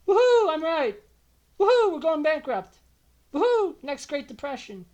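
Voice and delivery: loud, high sarcastic woohoo; mock celebration. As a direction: cheer bitterly, as if vindicated. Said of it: "how to feel vindicated".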